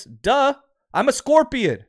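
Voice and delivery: scorpion's playful duh-answer; mock obvious. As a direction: toss it off as if self-evident.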